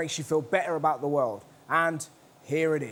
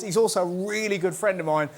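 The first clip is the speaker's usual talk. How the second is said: ordinary talk, nothing marked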